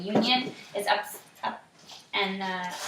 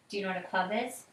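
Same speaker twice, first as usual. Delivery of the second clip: ordinary delivery, nothing marked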